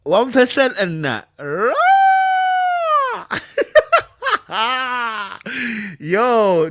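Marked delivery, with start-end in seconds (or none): 1.8-5.9 long, high shrieking laugh; loud laughter. Real, not acted. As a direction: crack up loudly. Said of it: "these people are making me laugh".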